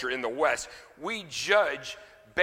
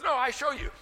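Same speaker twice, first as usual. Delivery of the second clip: high-pitched mock-Polish accent; high Polish-accented retort; 12 semitones higher than usual. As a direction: imitate a Polish janitor insisting, pitched way up. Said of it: "in his Polish accent".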